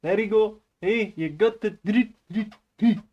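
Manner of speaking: put-on high Indian accent; playful high-pitched Indian accent. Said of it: "you picked Indian for that reason".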